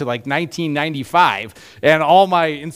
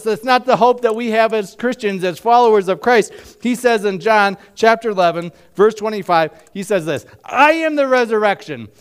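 higher, louder preacher climax; preaching at full force; 9 semitones higher, 4 dB louder than usual. Acted. Pastor proclaiming Jesus' promise to the congregation, full-force preaching. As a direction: lift the voice and proclaim it to the church.

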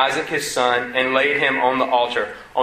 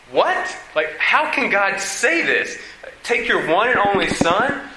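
high, slow disbelieving question; stunned, pitched-up objection; incredulous protest; 6 semitones higher, slower than usual. Pretend. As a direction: ask it as if it can't be true. Voicing listener's protest.

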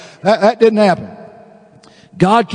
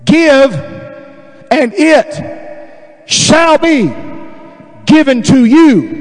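slow, raised, emphatic scripture reading; real slow reading; 6 semitones higher, much slower than usual. Shown as a demonstration. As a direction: proclaim it haltingly, phrase by phrase. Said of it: "read it to you real slow".

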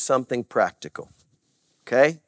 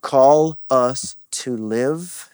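prayer; 7 dB louder, much slower than usual. Acted. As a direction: speak to God humbly, slowly. Leads a prayer.

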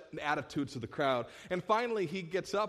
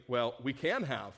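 ordinary talk, nothing marked

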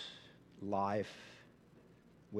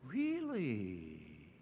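hushed, intrigued "Really?"; mysterious, invitational "really". Shown as a demonstration. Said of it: "more like mysterious and invitational".

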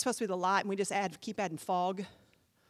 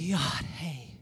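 Yod He Vav He said low and soft, like a breath; breathed name; 10 semitones lower, 6 dB quieter, slower than usual. Shown as a demonstration. Pastor breathes God's name for the church, low and hushed. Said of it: "supposed to make you think of breathing".

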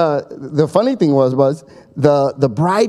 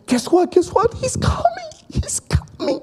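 high, rising squeal that falls to a breathless whisper; thrilled, barely able to contain it; excited bride. Retold or imitated. Bride hears the groom coming.

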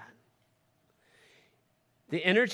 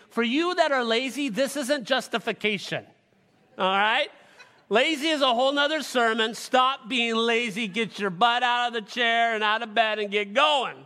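louder, higher stern scolding; 6 semitones higher, 5 dB louder than usual. Acted. Preacher to lazy members, loud scolding. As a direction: scold the lazy ones out of bed.

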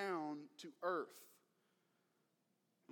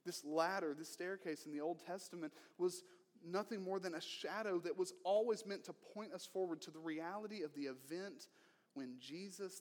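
normal talking voice

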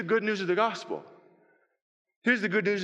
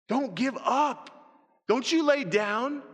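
slow exhortation; 5 semitones higher, slower than usual. Acted. Exhorting the congregation, quieter reprise.

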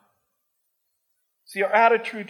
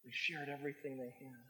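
hushed repeat; 6 semitones lower, 17 dB quieter than usual. Acted. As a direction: let the words sink in softly. Soft repeat of the verse.

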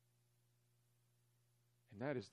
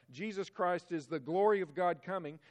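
ordinary talk, nothing marked

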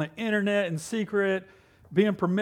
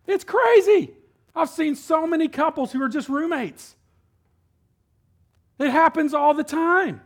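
much higher and louder, incredulous; fired up; 10 semitones higher, 7 dB louder than usual. Real, not acted. Preacher to congregation on sexless marriages, loud and high. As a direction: preach it with alarm.

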